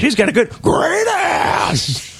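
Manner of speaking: mock movie yell. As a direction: bellow it like a bad gangster. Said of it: "terrible impression".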